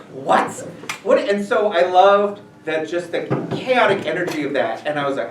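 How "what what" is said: loud, incredulous "what?"; mock disbelief. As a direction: imitate friends' stunned outburst. Friends shocked by Darth Vader answer.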